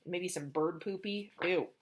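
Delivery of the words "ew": plain reading voice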